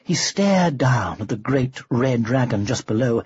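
ordinary delivery

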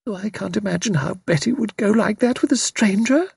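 high-pitched, dazed wondering. A narrator's reading. Bewildered mother being led back indoors, dazed. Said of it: "murmuring in a dazed fashion".

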